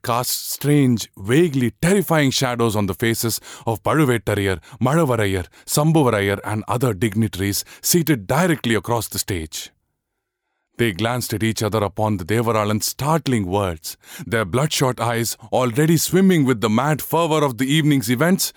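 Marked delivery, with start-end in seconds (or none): none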